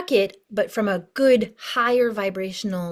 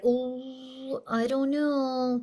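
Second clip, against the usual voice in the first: slow, higher, wavering-in-doubt voice; hesitant worried emotions; 3 semitones higher, 5 dB quieter, much slower than usual. Acted. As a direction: drag it out softly, acting reluctant. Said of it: "your emotions kick in, like".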